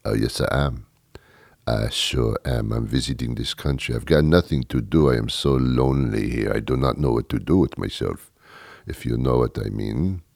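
low, soft fake French accent; hushed, suggestive mock-French seducer; phony French accent. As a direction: play the lonely visitor in a French accent. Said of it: "you do it with a French accent".

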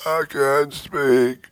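high, soft, strained croak; fake laryngitis. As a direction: fake a lost voice, barely speaking. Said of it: "I'd point to my throat and I'd go".

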